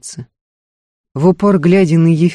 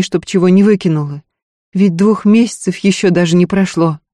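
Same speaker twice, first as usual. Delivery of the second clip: higher wife's voice, aggrieved and insistent; Устинья, vexed wife; 5 semitones higher, 4 dB louder than usual. A narrator's reading. Wife argues with Ефим about Васёнка, vexed. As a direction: pour out the grievance, then plead Васёнка's case.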